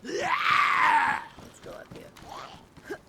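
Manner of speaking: very high, loud, drawn-out yell; frantic screamed escape; panicked scream. Real, not acted. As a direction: scream while running away. Fleeing priest zombie.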